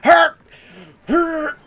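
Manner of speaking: high, loud cartoon yelp of pain; hammed-up scream of agony; mock pained scream. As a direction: yell it out like it suddenly hurts. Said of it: "he starts going".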